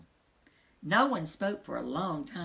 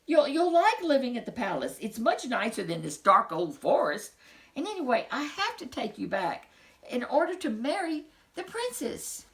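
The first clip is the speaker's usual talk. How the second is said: higher, coaxing reassurance; gently coaxing; 6 semitones higher, 4 dB louder than usual. Acted. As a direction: talk the sad snozzle round. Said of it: "said Bernard".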